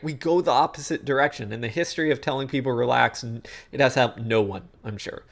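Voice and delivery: usual speaking voice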